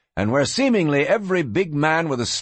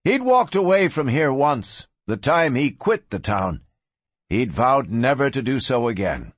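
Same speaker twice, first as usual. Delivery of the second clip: ordinary delivery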